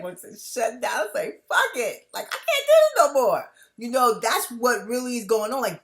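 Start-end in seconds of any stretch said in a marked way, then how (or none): 1.5-3.4 loud, high-pitched cry of giving up; fed-up outburst. Acted. Voicing the part that wants to shut down, fed-up outburst. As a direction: explode as if you've hit your limit. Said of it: "part of me is like".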